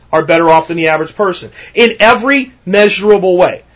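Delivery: ordinary delivery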